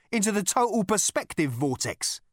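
loud, high and emphatic; ominous threat. A narrator's reading. Rooster reveals Zaphod's fate on Frog Star, menacing. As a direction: deliver the dreadful punchline with force.

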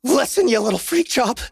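scared but trying to sound tough; panicked threat. Acted. Threatening the girl, terrified.

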